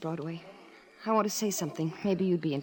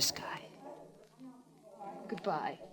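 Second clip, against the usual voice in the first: whispered goodbye; 4 semitones higher, 15 dB quieter, slower than usual. Acted. Sarah whispering her final dismissal to Sky. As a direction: drop to a cold whisper to end it.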